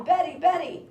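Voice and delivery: high mock calling out; mock yell.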